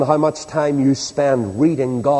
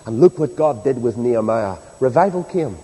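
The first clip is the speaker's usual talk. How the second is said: ordinary talk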